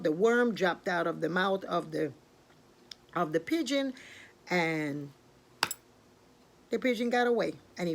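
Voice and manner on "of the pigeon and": ordinary delivery, nothing marked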